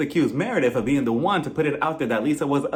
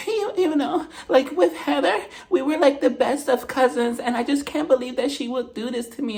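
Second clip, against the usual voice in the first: mock crying whine; 7 semitones higher than usual. Mimicking Whitney crying to Meredith, high teary whine. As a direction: put on a weepy high whine. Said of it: "Whitney's just like".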